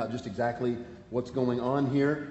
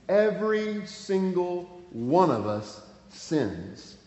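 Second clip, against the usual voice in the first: slow emphatic stress; 5 semitones higher, much slower than usual. Real, not acted. Preacher stresses universal sin.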